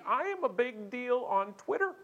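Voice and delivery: high-pitched diva boast; mock self-important. Offended passenger demanding airline make amends, high-pitched pomposity. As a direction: raise the pitch in hammed-up indignation.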